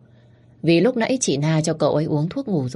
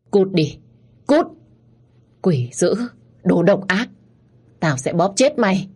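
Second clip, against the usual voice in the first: mildly raised, angry outburst; growled threat; 3 semitones higher than usual. A narrator's reading. Young master threatens while choking the narrator, growling. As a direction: spit out short threats, menacing. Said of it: "sau đó gầm gừ".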